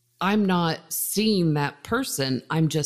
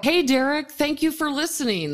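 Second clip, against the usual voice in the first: bright greeting; 9 semitones higher than usual. Real, not acted.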